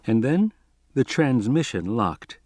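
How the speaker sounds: plain reading voice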